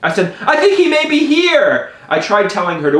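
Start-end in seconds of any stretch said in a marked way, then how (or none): 0.5-1.9 high, alarmed voice; urgent and pitched way up; frantic warning. Retold or imitated. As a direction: warn her in a panic. Frantic warning to his mom.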